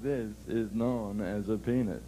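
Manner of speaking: low, slow mock-dad voice; boy acting like father. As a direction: copy dad's solemn explanation. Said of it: "They act like the father".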